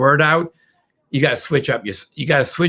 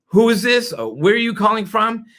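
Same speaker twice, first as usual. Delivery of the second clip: high, inquisitive question; a prospect cutting in, high and curious; 7 semitones higher than usual. Retold or imitated. Prospect interrupting the salesperson, curious and high. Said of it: "when somebody says".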